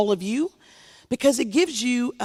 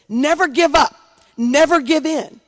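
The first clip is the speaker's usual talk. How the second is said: a hint of John Lewis, loud and higher; John Lewis's rallying words; 4 semitones higher, 6 dB louder than usual. Congresswoman echoing John Lewis to the crowd, loud rallying proclamation. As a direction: proclaim his motto like a preacher. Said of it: "You can hear John Lewis say it".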